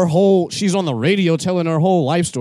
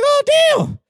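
much higher shocked exclamation; surprised; 4 dB louder than usual. Retold or imitated. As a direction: gasp it out in disbelief. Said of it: "Then she's like".

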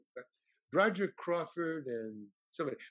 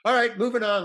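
loud, raised order to the crew; brisk director's call; 7 semitones higher, 10 dB louder than usual. Retold or imitated. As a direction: call everyone on to the next shot. Director moves crew along.

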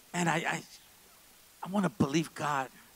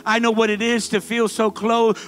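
building to a loud, higher preacher's cry; rising preaching; 5 semitones higher, 7 dB louder than usual. Real, not acted. Pastor stirring the congregation, building in force. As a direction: swell over the music toward the peak.

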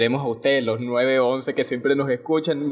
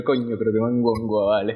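dinosaur voice; 7 semitones lower than usual. Pretend. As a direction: sigh it out low and defeated. Dinosaur groans as meteor hits.

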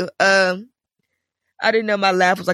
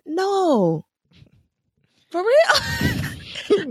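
shocked disbelief; 13 semitones higher, much slower than usual. Retold or imitated. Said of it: "I said".

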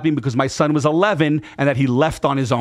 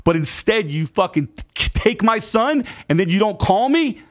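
raised pitch, incredulous retold tirade; indignant confrontation; 5 semitones higher than usual. Brother confronts sister for taking his son, indignant. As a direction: lay into her with mounting disbelief, re-enacted. Re-enacted angry quarrel with sister.

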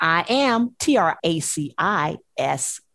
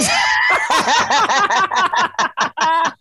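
big loud laugh; loud burst of laughter; 9 semitones higher, 4 dB louder than usual. Real, not acted.

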